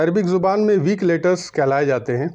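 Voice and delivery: ordinary talk, nothing marked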